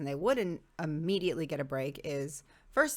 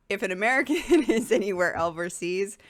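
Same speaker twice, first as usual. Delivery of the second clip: loud, higher, laughing through the words; 4 semitones higher, 10 dB louder than usual. Real, not acted. Speaker explaining how Americans are seen overseas, laughing as they talk. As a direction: break into real laughter mid-sentence.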